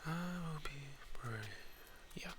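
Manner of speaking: whispered self-encouragement. Real, not acted. Bracing for enemies.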